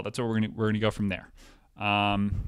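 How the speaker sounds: ordinary delivery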